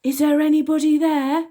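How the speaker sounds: put-on high, loud girl calling out. A narrator's reading. Elsie calling out in the tower, high and loud. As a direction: call nervously into the dark. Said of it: "asked Elsie".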